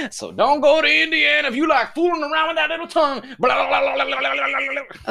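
high, loud Southern holler running into babble; hammed-up Southern accent, tongue-wagging blahs. Host mocking Indiana's mouth law, Southern accent. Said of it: "I use the same Southern accent for everything".

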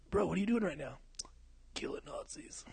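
hushed, breath-only mock stoner; whispered stoner bit. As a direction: whisper it in character. Continues the stoner character bit.

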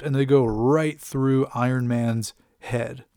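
ordinary delivery

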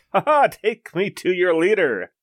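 flexing bravado. Acted. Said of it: "I flex and say".

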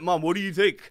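high, loud, laughing put-on innocence; mock innocent son. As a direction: play dumb to your mum, giggling. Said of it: "I'm like".

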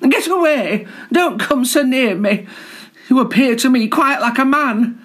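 high, alarmed ladylike voice; startled prim Garter. Acted. Garter fends off the boastful collar, high and flustered. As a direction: shoo him off in a high fluster.

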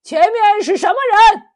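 high, loud guard's challenge; full-throated shout. A narrator's reading. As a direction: bellow a challenge across the water. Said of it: "锦衣卫士忙拉直了喉咙喊".

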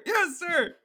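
very high mock recruit yell; hammed-up, straining military reply; screamed reply. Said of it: "he keeps screaming".

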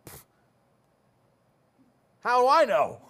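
pfft, laughing it off; scoffing laugh. Retold or imitated. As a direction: brush it off with a laugh. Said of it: "I was like".

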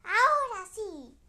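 loud, high, slow now yes; delighted shout. Acted. Princess cheering that the spell finally worked, loud and high.

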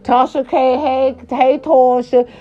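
hey-hey hailing a friend by name; calling out to Tasha K. Retold or imitated. Man at the table calling to Tasha K, lightly mimicked.

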